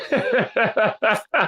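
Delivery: real amused laugh. Real, not acted. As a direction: burst of laughter. Laughing at the banter.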